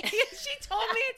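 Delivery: high laughing disbelief. Real, not acted. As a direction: laugh through the words. Sisters laughing about scary story.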